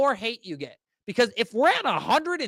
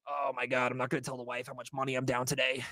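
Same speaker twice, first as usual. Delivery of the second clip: panicked investor; 7 semitones lower, 4 dB quieter than usual. Retold or imitated. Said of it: "You're like".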